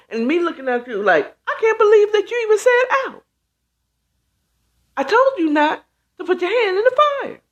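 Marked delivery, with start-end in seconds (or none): 1.5-7.4 high, fast exasperated scolding; scolding parent. Retold or imitated. Parent to the spanked child, a high fast scolding.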